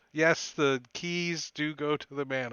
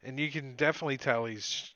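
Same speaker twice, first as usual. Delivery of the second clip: ordinary talk, nothing marked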